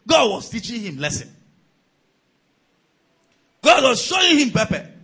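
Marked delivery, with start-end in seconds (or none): 3.6-4.9 mock exclaim. Mocking gossip punchline.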